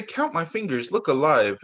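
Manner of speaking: usual speaking voice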